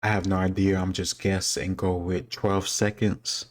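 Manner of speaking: normal talking voice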